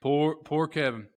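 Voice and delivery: ordinary talk, nothing marked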